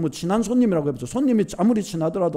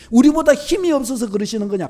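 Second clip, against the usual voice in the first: normal talking voice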